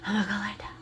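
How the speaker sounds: soft, whispered imitation of a child; hushed mock-compliant child voice; imitated child mumble. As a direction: whisper it like a kid giving in. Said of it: "And she's like".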